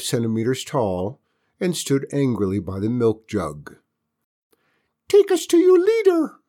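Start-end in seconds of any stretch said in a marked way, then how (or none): 5.1-6.4 very high, loud squeaky alien; tiny alien voice. Acted. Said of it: "cried one".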